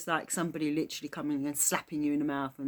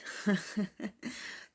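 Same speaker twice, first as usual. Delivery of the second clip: breathy laugh; 4 semitones higher than usual. Real, not acted. Laughs at the word gutted.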